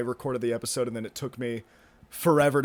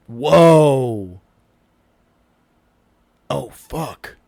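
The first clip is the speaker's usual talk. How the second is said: startled; 11 dB louder, much slower than usual. Real, not acted. Thunderstorm rolling in, startled.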